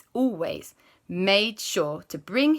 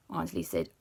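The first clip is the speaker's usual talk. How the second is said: plain reading voice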